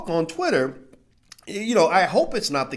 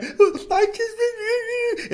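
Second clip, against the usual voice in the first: high, whiny fake sob voice; mock crying woman. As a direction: squeak it out like a woman crying to dodge blame.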